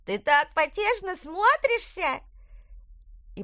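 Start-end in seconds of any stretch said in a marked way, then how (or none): 0.0-2.2 much higher, slower, teasing creature; high mocking pumpkin voice. A narrator's reading. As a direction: tease her in a squeaky voice. Pumpkin crew laughing at witch.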